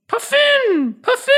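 high squawking bird cry; puffin call impression. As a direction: screech it like a puffin. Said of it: "That's a really good impression".

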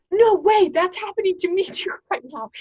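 high, loud burst of surprise; amazed. Retold or imitated. Speaker telling her brother her mouth is numb too, loud and amazed. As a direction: jump up in pitch, amazed.

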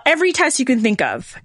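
ordinary talk, nothing marked

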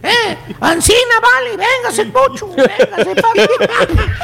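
lightly imitated rural Mexican ranch twang, slow on Ancina; a mild hint of a country Mexican accent, drawn out and slow; ranchero drawl imitation. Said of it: "y te contestan".

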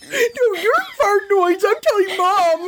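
put-on high whiny kid voice; bratty little sibling tattling; childish threat. Sibling squabble parody.

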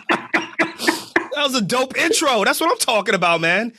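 high, hyped-up praise; pumped up and delighted; excited. Real, not acted. Guest praises the intro.

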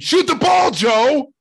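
high, full-volume frustrated yell; screamed. Retold or imitated. Fan watching the game on a laptop, screamed. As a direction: scream it at the screen. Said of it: "and I screamed".

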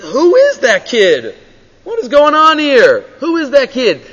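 acted, high-pitched bewildered questioning; baffled onlooker. King watching an unknown kid on the battlefield, baffled. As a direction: demand to know what's happening.

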